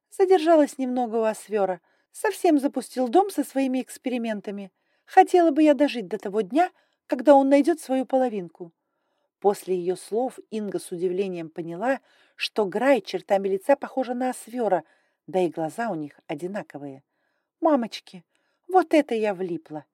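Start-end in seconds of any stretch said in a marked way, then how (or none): 0.1-8.7 higher, louder, brisk and imperious; haughty dragon lady. Acted. Grai voiced higher and louder. 17.6-19.8 higher, louder flash of panic; panicked thought. Acted. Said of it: "мелькнула паническая мысль".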